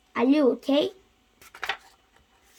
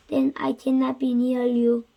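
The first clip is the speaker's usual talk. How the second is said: usual speaking voice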